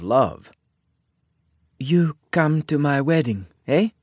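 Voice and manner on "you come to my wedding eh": put-on higher-pitched Italian-accented English; heavy Italian accent. A narrator's reading. Isabella invites the sheriff to her wedding, Italian accent. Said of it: "Isabella asked in her heavy Italian accent".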